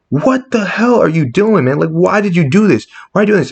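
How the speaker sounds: high, exasperated imitation of a scolding; imitated stern confrontation. Captain Marvel confronting Hawkeye, stern and appalled. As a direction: demand answers, drawing out the opening question. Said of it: "she's all with the cold and hard".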